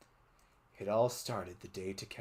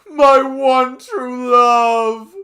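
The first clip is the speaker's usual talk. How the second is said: wail; 19 dB louder, much slower than usual. Acted. Morgana mourning.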